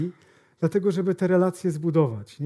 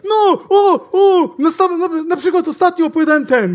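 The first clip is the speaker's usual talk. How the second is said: mock eager stammer, much higher and louder; hammed-up nervous salesman; 10 semitones higher, 8 dB louder than usual. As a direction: trip over the words excitedly. Salesman stammering, high loud.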